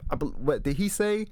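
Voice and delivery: ordinary talk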